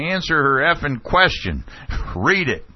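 high, mild mock heckle; retold audience cries. Audience heckling the official, mildly imitated cries. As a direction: imitate a crowd member calling out. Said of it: "cries from the audience".

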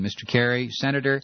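ordinary talk, nothing marked

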